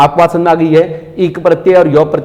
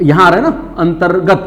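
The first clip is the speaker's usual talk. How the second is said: ordinary talk, nothing marked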